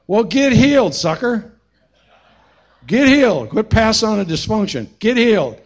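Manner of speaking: high, loud preacher's call to get healed; forceful exhortation. Real, not acted. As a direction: bark it out like a revival preacher. Preacher exhorts fathers to heal.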